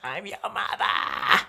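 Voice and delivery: very high, slow full shout; screaming mother. Retold or imitated. Actor screaming into his co-star's face, full shout. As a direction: blast it like a furious drag mum. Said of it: "a fellow actor screaming in your face".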